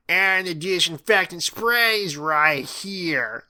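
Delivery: high, loud, drawn-out teacher voice; Missus King. A narrator's reading. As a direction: stretch the order out, brooking no argument. Teacher cuts pupil off.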